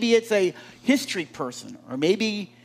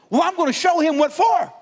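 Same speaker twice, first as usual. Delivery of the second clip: hammed-up high, indignant outburst; hot-headed driver; 8 semitones higher, 4 dB louder than usual. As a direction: play a fired-up driver vowing payback. Acted road-rage driver vowing revenge.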